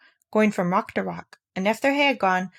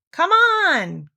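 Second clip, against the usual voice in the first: high, loud call to the others; 11 semitones higher, 5 dB louder than usual. A narrator's reading. Christopher Robin calling back to the line, loud call. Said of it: "called Christopher Robin".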